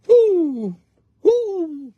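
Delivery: high falling "ooh"; mock "ooh". Teasing a companion after mimicking them, high drawn-out oohs. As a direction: let out two high, swooping oohs.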